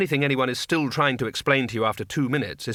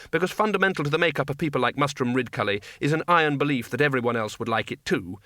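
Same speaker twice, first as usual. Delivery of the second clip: normal talking voice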